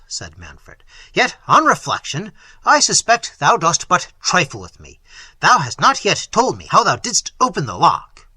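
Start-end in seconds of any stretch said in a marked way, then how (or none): 1.1-8.3 loud, commanding prince; forceful prince addressing a defiant youth; stern prince. Acted. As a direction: challenge the peasant forcefully. Voices Manfred, the prince.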